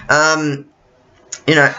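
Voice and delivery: ordinary talk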